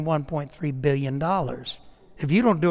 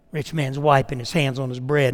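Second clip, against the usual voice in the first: ordinary delivery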